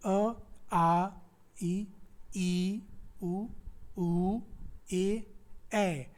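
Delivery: अ, आ, ई, ऊ recited one by one as pure vowels; each vowel from अ to ए said alone, with a pause after; vowel recital. Shown as a demonstration. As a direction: chant out अ, आ, ई, उ, ऊ, ए separately. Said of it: "आपको कि जो स्वर हैं".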